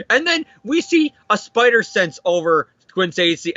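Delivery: ordinary delivery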